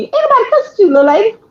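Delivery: high-pitched protest, retold; exasperated retort. Grandchild protesting to grandmother on the phone, exasperated retort. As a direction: talk back in mock indignation.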